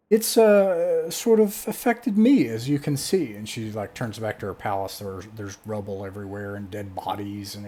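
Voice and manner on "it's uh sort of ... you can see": high, loud, emphatic voice; grand fairy godmother. Acted. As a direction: project it high to the whole party. Figure addressing the guests.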